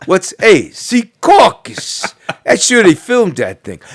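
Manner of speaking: loud, high, exaggerated New Jersey accent; mock a fake Jersey accent at full volume; hammed-up, drawn-out Jersey tough-guy accent; make-believe Jersey accent. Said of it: "talk with this make-believe accent".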